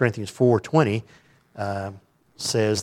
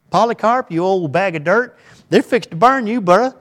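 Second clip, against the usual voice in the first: mock taunt; 9 semitones higher, 7 dB louder than usual.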